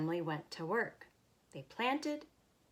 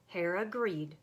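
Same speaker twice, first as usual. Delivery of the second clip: plain reading voice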